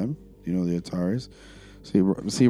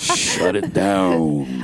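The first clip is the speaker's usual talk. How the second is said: very slow "shut it down"; drawn-out command; 4 semitones lower, 4 dB louder, much slower than usual. Pretend. Calling a halt after a laugh, long drawn-out delivery. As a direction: stretch each word out long and low.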